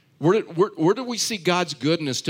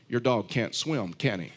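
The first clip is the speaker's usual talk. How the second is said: deadpan punchline; 5 semitones lower, 4 dB quieter than usual. Retold or imitated.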